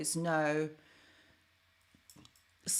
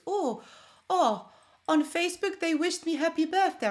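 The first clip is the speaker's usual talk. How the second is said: much higher, delighted fatherly voice; excited dad impression; 9 semitones higher, 5 dB louder than usual. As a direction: play the thrilled dad who mixes up the websites. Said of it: "He goes".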